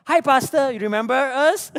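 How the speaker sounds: loud, high, eager hail; chirpy caller greeting. Acted. Church phoning the pastor a year later, loud and chirpy. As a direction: pipe up brightly as an eager caller. Church calling back, retold.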